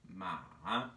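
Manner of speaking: "ma" low and dipping, then rising slightly; third tone ma. Shown as a demonstration. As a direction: dip "ma" low and come back up. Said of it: "goes down and then slightly back up".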